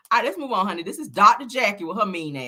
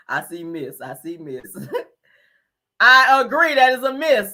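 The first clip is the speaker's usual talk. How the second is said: usual speaking voice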